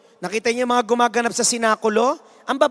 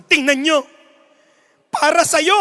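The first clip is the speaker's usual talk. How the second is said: louder, higher dramatic announcer; rising announcer; 4 semitones higher, 5 dB louder than usual. Acted. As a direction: build the drama up. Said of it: "Sabi ng announcer".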